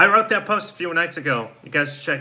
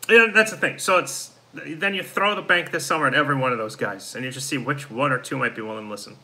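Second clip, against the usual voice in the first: usual speaking voice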